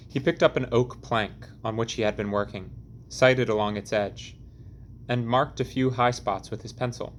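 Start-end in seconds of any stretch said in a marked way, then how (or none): none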